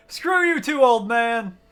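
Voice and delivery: shouting in fake offense; playfully yelling at the screen; mock shout. Real, not acted. Yelling at a game character.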